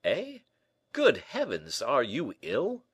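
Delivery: startled, high-pitched concern; alarmed cry. Acted. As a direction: exclaim in alarm at a friend. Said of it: "cried D'Artagnan".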